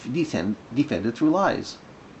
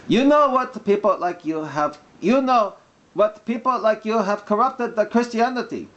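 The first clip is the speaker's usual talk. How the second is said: mock Arabic accent; 9 semitones higher than usual. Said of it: "when I'm doing the mock Arabic accent".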